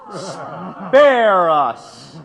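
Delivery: host loudly scoffing at Parsons' complaint; scornful outburst. Acted. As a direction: shout him down with a laugh. Businessman cuts off Parsons.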